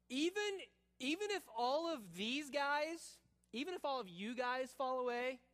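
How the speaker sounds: high-pitched, boastful disciple; arrogant chutzpah. Acted. Peter boasting to the Lord before all the disciples, arrogant. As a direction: boast over the heads of the others. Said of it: "chutzpah and arrogance".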